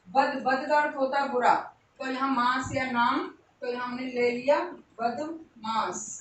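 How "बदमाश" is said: drawn-out dictation. Shown as a demonstration. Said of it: "teacher sounds out word".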